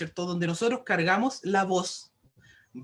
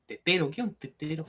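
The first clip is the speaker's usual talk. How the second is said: ordinary delivery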